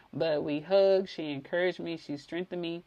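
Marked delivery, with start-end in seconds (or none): none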